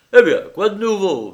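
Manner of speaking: loud, high, breezy question; big-voiced casual greeting; hearty host. A narrator's reading. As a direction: boom it out, all cheer. Lyra greets visitor breezily.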